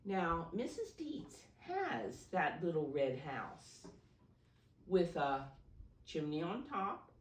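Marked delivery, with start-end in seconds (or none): none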